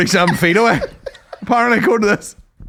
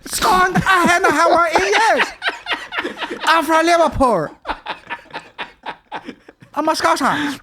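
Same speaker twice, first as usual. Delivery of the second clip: high, laughing put-on Liverpool accent; hammed-up Scouser, cracking up; mocking the friend's Liverpool accent; mock Scouse accent; 11 semitones higher than usual. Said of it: "Your accent".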